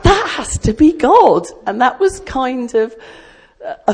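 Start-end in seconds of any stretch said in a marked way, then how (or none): 0.0-1.5 high, loud, half-laughing awe; amazed realisation. Retold or imitated. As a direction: blurt it out as the penny drops. Realising the voice was God.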